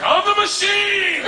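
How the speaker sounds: high, drawn-out holler; slow, loud, high-pitched boast; proud yell. Retold or imitated. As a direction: belt it out like a catchphrase. Repeats his blunder to gangster.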